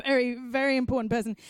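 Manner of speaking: fast laughing tease; laughing, teasing. Real, not acted. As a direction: rattle it off through a laugh. Host laughing, teasing guest.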